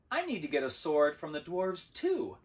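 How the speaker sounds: cheerful. A narrator's reading. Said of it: "I said cheerfully".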